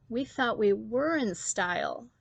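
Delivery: plain reading voice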